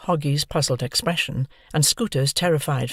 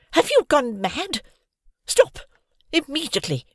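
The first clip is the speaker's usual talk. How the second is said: outraged scream; 12 semitones higher than usual. Acted. Missus Hamilton ordering Hoggy to stop, furious. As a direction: order her off at full shout. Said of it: "she screamed".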